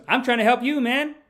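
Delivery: high, insistent heckler's holler; loud protest. Retold or imitated. Guy in the audience insisting he's helping, loud protest. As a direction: imitate a heckler defending himself, loudly.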